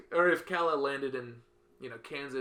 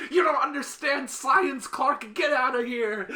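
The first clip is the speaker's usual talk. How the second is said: high, loud, slow and haughty, a cartoon know-it-all; mock snooty Lex; 12 semitones higher, 9 dB louder, slower than usual. Teen Lex scolds the sick Clark in a high, loud, haughty voice. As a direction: look down your nose at him and shoo him off.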